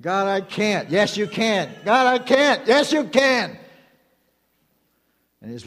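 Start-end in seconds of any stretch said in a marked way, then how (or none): 1.8-2.7 "God, I can't" pitched higher and louder; insistent protest. Acted. Believer arguing harder with God, raised insistent protest. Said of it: "And God's saying". 2.7-3.6 emphatic reply. Acted. God insisting back at the believer, emphatic. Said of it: "And God's saying".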